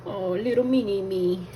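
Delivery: high little squeaky coo; cutesy cooing. Pretend. Cook fussing over a tiny dumpling, cutesy high voice. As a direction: coo it way up high like baby talk.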